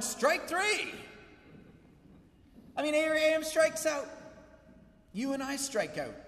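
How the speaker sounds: high, loud climactic preaching; preaching at full force. Acted. Pastor to his church, rising to a loud preaching climax. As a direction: drive it home to the crowd.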